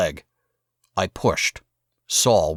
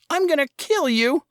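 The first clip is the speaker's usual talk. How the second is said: put-on high, furious kid scream; Saul screaming. A narrator's reading. Saul screams at his brother, high and furious. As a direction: scream it like a boy who lost it. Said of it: "Saul screamed".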